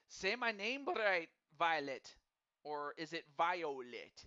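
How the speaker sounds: put-on a weak, slow Irish accent; half-hearted Irish lilt, slow and loud; mock Irish accent. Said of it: "I can't do, um, an Irish accent all that well".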